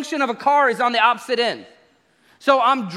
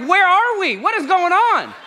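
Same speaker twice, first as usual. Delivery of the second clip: higher, louder baffled outburst; can't believe what you're hearing; shocked tourist at the sushi table; incredulous disbelief; 8 semitones higher, 3 dB louder than usual. Retold or imitated. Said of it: "I was like".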